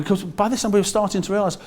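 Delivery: usual speaking voice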